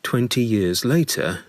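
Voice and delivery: plain reading voice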